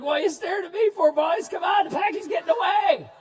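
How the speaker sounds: high, loud eager shout; excited kid shouting. Retold or imitated. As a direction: yell it like a thrilled kid joining the hunt. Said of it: "I'm like".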